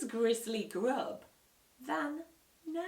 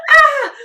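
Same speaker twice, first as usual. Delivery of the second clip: high, loud panicked squeal; 26 dB louder than usual. A narrator's reading. Dad hearing the doorbell too early, loud squeal. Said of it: "squealed Dad".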